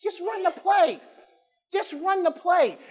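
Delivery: high, insistent coach calling the play; coach's command. Retold or imitated. Coach telling the team to just run the play, insistent and high. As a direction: bark it like a coach on the sideline. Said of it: "Take your neighbor and say".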